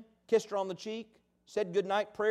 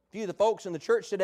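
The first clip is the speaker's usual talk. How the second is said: normal talking voice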